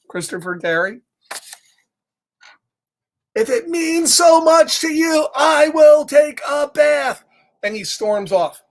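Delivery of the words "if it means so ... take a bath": high, loud, slow huffy shout; angry yell. Acted. Pigeon furiously agreeing to bathe, loud huffy shout. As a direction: storm off shouting it.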